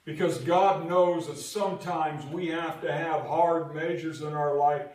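full-force preaching. Acted. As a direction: preach it to the back row.

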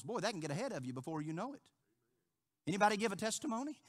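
ordinary talk

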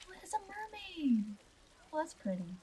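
high, soft coo of wonder; high-pitched gentle awe; delighted discovery. Real, not acted. Delighted at spotting the fountain.

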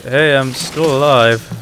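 loud, slow silly guy voice; goofy mock character. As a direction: voice him like a cheerful dope. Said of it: "just like".

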